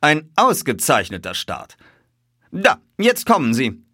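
eager spectator; exclaiming. A narrator's reading. Detective watching the horses come, exclaiming. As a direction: call it out with rising excitement. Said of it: "rief er".

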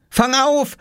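put-on high, urgent boyish call. A narrator's reading. Oskar throwing the key from the window, calling out. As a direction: call out a quick warning. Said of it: "rief er".

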